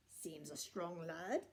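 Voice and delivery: low soft worried giant. A narrator's reading. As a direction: mutter it, worried. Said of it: "getting a little bit worried".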